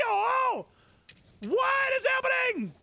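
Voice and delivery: shocked out of your seat; stunned shout. Real, not acted. Gamer shocked by a surprise shiny, stunned shout.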